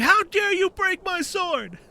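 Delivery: high, drawn-out indignant cry; mock outrage. Enraged sword maker charging with knife.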